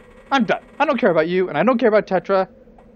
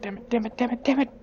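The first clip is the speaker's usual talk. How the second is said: panicked muttering; 4 semitones higher, 6 dB quieter, faster than usual. Acted. Keeps panicking under his breath.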